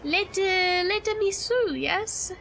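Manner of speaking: hesitant, badly faked French accent; fake French accent. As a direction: plead in a silly French accent. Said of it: "I do not speak le French".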